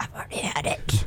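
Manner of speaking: hushed exhausted whisper. Retold or imitated. Guest imitating her worn-out self to the host, whispering. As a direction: breathe it out, drained and tired.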